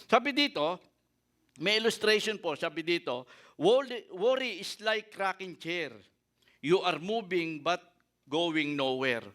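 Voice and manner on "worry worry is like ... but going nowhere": slow, deliberate reading of a saying; quoted saying. Acted. Preacher reading the rocking chair illustration, slow and deliberate. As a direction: let each phrase land slowly.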